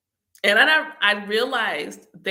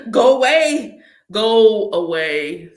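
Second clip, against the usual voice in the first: firm shoo; 8 dB louder, much slower than usual. Retold or imitated. Shooing away the guy.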